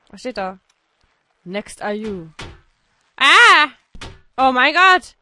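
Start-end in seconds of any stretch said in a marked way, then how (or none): 3.2-5.1 loud frightened yell; real jump-scare yell; startled shout. Real, not acted. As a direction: cry out in real shock.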